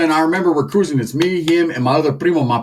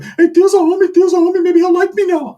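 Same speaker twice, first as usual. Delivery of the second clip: put-on much higher, boyish eager voice; Ricky's whiny kid voice; 3 dB louder than usual. Comedian voicing cousin Ricky bragging about tio, high boyish voice. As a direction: gush like a naive youngster. Ricky running around happy.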